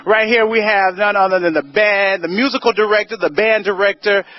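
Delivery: bubbly red carpet host; gushing red carpet chatter. Acted.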